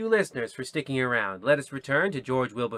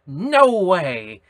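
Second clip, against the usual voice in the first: "No way!" called out louder and higher; mock shout; 5 semitones higher, 8 dB louder than usual. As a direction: play a listener blurting out in shock. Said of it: "there's a chance you will shout".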